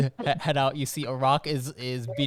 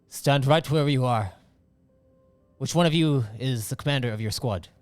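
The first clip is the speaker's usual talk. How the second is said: commanding voice; 6 dB louder than usual. Acted. Stern commander addressing the squad.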